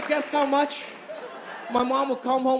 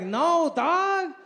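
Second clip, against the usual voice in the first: cocky contestant; 6 semitones higher, slower than usual. Retold or imitated. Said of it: "And he was like".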